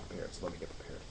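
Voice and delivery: whispered to himself; breathy whisper, bracing up. Real, not acted. Bracing himself before the list.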